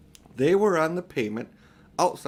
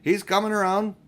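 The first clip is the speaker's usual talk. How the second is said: a voice from the crowd, pitched higher and louder; bystander in the crowd; 7 semitones higher, 5 dB louder than usual. A narrator's reading. A bystander calls to the crowd, raised and higher. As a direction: call it out over the street crowd. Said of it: "saying things like".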